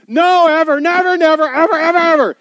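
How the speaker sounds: shouted high and loud, "No, ever, never, never, ever" hammered; emphatic shout. Shown as a demonstration. Preacher shouting the Greek emphasis at the congregation, loud and high. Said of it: "It's totally emphasized at the beginning and the end".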